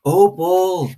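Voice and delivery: a called name, high and carrying; calling out. Acted. Preacher calling Opal from the porch, called out. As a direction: call across the yard to the girl.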